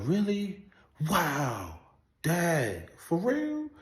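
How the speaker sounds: mock gullible mother, amazed; gullible amazement. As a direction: sound completely taken in by the story. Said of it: "my mother would be so into the story".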